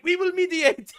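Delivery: high, loud hammed-up French accent; mock French voice. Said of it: "And then France is like".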